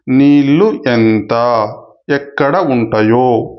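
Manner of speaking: very slow, word by word; teacher separating the joined words slowly; slow split demo. Shown as a demonstration. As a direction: pull "నీళ్ళు ఎంత" apart slowly. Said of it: "కలిపినప్పుడు".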